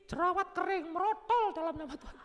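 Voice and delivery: high, loud hammed-up rebuke; mock prayer. Believer jokingly rebuking a pimple, high and hammed-up. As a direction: command the pimple away jokingly.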